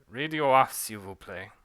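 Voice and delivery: put-on master giving an order in a French accent; curt French accent; light French accent, commanding. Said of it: "a British and French accent".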